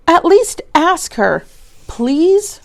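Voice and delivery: put-on high, pleading child voice; a small boy begging his teacher. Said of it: "Richie begged".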